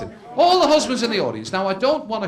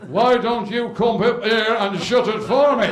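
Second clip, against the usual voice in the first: challenge; 2 semitones higher, 4 dB louder, slower than usual. Acted. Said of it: "trumpet call to the U S cavalry".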